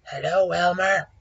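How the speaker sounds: lightly voiced tiger greeting. A narrator's reading. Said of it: "Hello, Tiger".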